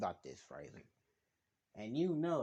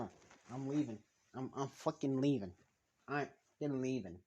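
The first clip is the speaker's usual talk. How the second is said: ordinary talk, nothing marked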